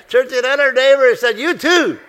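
high, loud and laughing, teasing the room; playful high call. Real, not acted. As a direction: tease the congregation, laughing aloud. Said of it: "playful call to the congregation".

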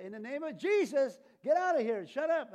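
loud, high shouted rebuke; irritated command. Acted. Kenneth ordering the demon to leave, irritated shout. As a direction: yell it as a fed-up exorcism. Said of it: "so irritated, irritated".

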